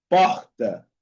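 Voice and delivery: "porta" with the R as a throaty Spanish J. Shown as a demonstration. Teacher showing Rio pronunciation, syllable-final R as jota. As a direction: say "porta" like "pojta", breathy scrape before the T. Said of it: "pronuncian como la J en español".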